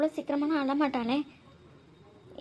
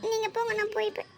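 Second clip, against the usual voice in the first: tearful; 7 semitones higher than usual. Acted. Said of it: "அழுதுட்டே தான் இருப்பேன்".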